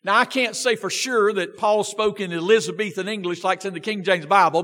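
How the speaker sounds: ordinary talk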